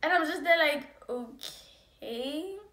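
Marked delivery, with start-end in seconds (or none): 1.1-2.6 soft, drawn-out okay, lifting at the end; hesitant okay. Acted. Woman reacts to her staring ex, hesitant. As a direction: draw out a quiet, questioning okay.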